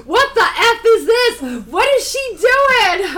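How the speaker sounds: high, loud, drawn-out imitation of disbelief; exaggerated incredulous squawk, half laughing; mock outrage. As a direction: shriek it as a hammed-up outraged quote. Retells his outraged reaction.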